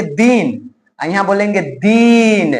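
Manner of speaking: slow, दिन short and दीन with a long ई. Shown as a demonstration. Teacher showing pure Hindi vowel length. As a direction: contrast दिन quick against a drawn-out दीन. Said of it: "शुद्ध हिंदी बोलने वाला ... यहाँ बोलेंगे".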